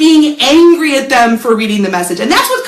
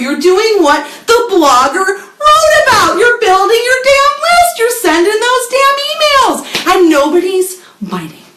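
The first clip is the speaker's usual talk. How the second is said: exasperated rant; 8 semitones higher than usual. Acted. Ranting about futile list work.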